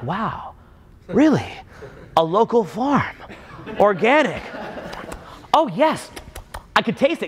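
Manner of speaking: hammed-up diner's awe, phrase by phrase; mock impressed. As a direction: savour each word as a gullible diner would. Mocks a diner impressed by waiter.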